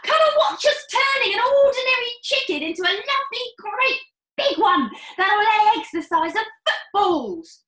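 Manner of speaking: fast, high, loud showman; father boasting to his wife, fast and loud; excited boast. Acted. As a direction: sell the miracle to her.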